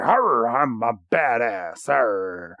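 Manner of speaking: low badass creature voice, played for laughs; mock tough growl. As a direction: growl like a cartoon tough guy. Voices tough-looking Houndoom in recap.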